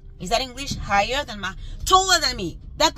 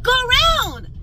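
high, loud and fed up; exasperated shout; 12 semitones higher, 7 dB louder, slower than usual. Real, not acted. Ranting at pedestrians who block her loaded hand truck, loud exasperated shout. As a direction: yell it at people blocking the way.

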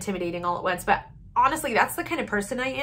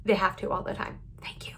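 whispered aside; 3 semitones lower, 6 dB quieter than usual. Real, not acted. Joking aside about family listening.

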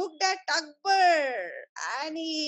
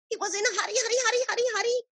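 rushed, higher-pitched repetition; hurried; 6 semitones higher, much faster than usual. A narrator's reading. Storyteller showing Akbar in a hurry, rushed and urgent. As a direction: rattle it off in a great hurry.

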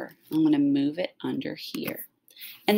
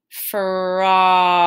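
"Frog" drawn out and a bit louder; slow and smooth; 4 dB louder than usual. Shown as a demonstration. Teacher builds "Frog" sound by sound for students. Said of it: "I'm gonna say it slow and smooth".

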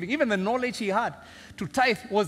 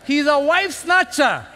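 loud, slow, high pulpit proclamation; full-force preaching; 8 semitones higher, 7 dB louder than usual. Acted. Preacher urging the congregation to remember leaders fail, loud and slow. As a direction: hammer the point home to the congregation.

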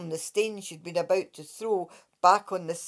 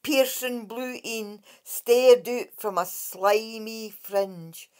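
usual speaking voice

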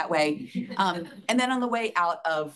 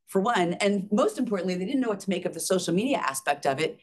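ordinary talk

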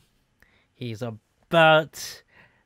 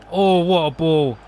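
loud, thrilled praise of the ball; excited cheer; 6 dB louder than usual. Real, not acted. Gamer cheering his own goal, loud excited.

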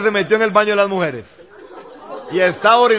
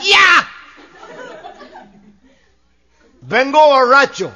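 much higher, louder bellow; drunk shout; 7 semitones higher, 5 dB louder, slower than usual. Acted. Drunk husband storming home, shouting at his wife. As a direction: kick the door in and bellow it.

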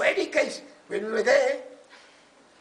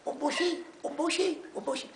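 higher-pitched mimicked chant; imitated supporters; 8 semitones higher, 5 dB quieter than usual.

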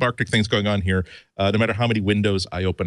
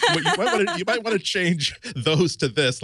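laughing retold suggestion; 8 semitones higher than usual. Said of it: "And I said".

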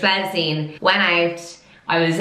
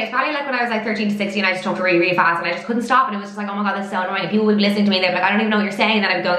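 rapid-fire demo of speed talking; really fast talk; much faster than usual. Shown as a demonstration. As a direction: rattle it off without stopping. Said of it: "try and see if I can talk really fast".

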